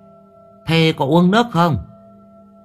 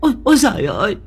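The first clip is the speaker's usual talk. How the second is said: high, shrieking cry of fear; old woman screaming in horror; terrified scream; 11 semitones higher, much faster than usual. A narrator's reading. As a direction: shriek at the ghost, much higher. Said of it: "Bà cụ Sâm rú lên".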